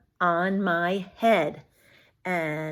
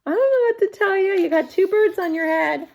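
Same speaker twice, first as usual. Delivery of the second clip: high, loud, rushed cartoon pig; Piggy shrugging to Elephant, high and fast; 11 semitones higher, 5 dB louder, faster than usual. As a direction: blurt it out like a flustered pig. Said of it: "Pig's like".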